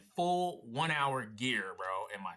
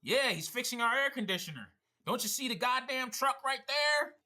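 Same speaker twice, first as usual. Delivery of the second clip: imitated homeowner, high indignant voice; mock telling off someone who missed the obvious; exasperated husband; 11 semitones higher, 3 dB louder than usual. Husband defends the repairman to the stranger, imitated exasperation. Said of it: "He's like".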